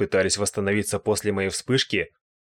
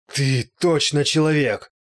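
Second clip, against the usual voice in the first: high, slow, strained exclamation; shocked outcry; 5 semitones higher, 4 dB louder, slower than usual. A narrator's reading. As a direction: gasp it out in disbelief. Призрак потрясён касанием. (потрясённый возглас)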